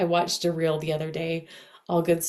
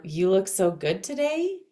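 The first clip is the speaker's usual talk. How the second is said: rising question tune up to TODAY; questioning inflection. Shown as a demonstration. Coach demonstrating inflection to listeners, questioning rise on TODAY. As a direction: lift "today" at the end as if doubting it. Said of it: "so there's that inflection".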